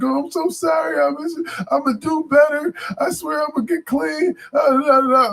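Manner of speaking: high, put-on begging voice of the crackhead lady; mock pleading lady. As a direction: plead like a street addict promising to change.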